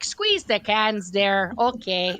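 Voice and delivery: slow, lightly put-on Midwest drawl; Midwest accent. Telling the course room what to do, slow Midwest accent. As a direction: stretch it out, Midwest style. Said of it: "I couldn't drop the accent".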